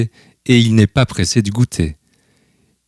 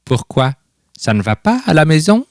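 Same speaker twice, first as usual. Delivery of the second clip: concerned grandfather; 7 semitones higher than usual. A narrator's reading. Grandfather questions worried grandson.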